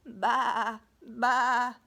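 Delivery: playful goat bleat. Acted. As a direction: baa it like a goat. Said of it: "All she heard was".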